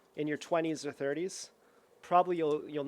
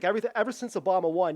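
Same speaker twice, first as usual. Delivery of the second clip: louder, higher, rushed mock complaint; mock indignant outburst; 4 semitones higher, 6 dB louder, faster than usual. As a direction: blurt it out as an imitated loud provocation. Retelling man provoking a couple.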